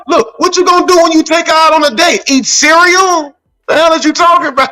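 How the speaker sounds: high-pitched, incredulous challenge; comic outrage. Real, not acted. Friend ribbing the guys over cheap dates, high and incredulous. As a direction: ride it up high in mock disbelief.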